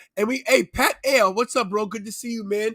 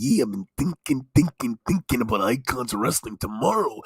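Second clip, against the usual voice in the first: Macho Man impression; 11 semitones lower than usual. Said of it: "I'm pretty good Macho Man guy".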